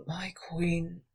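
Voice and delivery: soft whisper; quiet. A narrator's reading. Wounded thief whispers to his queen, quietly. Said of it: "he said quietly".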